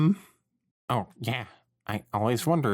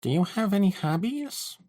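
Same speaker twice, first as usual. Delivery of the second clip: nervous high question; 10 semitones higher than usual. Acted. Panicking small talk.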